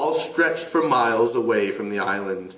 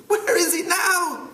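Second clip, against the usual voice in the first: put-on high, slow boy's voice; Piggy asking. Piggy pleading slowly for an answer. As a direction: draw the question out.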